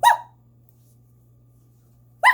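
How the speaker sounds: short high-pitched whoop; imitated hooting. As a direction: whoop like a hollering reveler. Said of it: "he's hooting and hollering".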